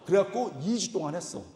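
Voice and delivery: ordinary delivery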